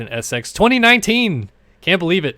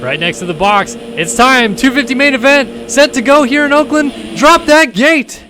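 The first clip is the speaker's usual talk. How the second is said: building race call; 9 semitones higher, 5 dB louder than usual. Acted. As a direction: build hype until the gate drops. Building to start.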